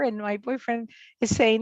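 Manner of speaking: ordinary talk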